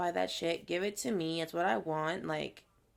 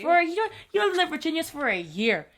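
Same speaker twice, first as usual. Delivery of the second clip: fast, loud, higher comeback; hammed-up outraged protest; mock indignation; 8 semitones higher, 5 dB louder, faster than usual. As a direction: fire back as if affronted. Retelling indignant comeback to a friend.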